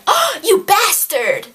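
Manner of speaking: high, drawn-out indignant cry; gasp, then exclaim it in hammed-up outrage; play-acted outburst at a villain; mock outrage.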